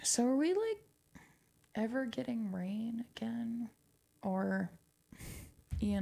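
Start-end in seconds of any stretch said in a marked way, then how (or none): 1.8-3.7 soft whispered question. Real, not acted. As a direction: drop to a hushed wonder. Wistful question about drought.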